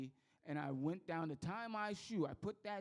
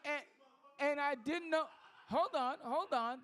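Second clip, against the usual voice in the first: much higher and louder, worked up; outraged; 8 semitones higher, 3 dB louder than usual. Real, not acted. Comic ranting to the crowd about quarters in his coffee, high and loud. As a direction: build the indignation to a peak.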